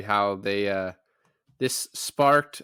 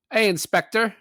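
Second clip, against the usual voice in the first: Cockney; 10 semitones higher, 5 dB louder than usual. Retold or imitated. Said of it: "Cockney is pretty easy".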